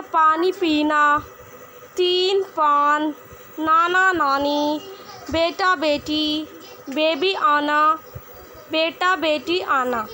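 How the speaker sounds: slow, word by word for students; slow read-along. Shown as a demonstration. Teacher reading words slowly for students to repeat. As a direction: spell out each word slowly so kids can follow. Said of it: "मेरे पीछे पीछे आप पढ़ने की कोशिश करिए".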